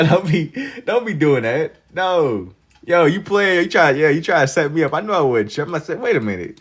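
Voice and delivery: ordinary talk, nothing marked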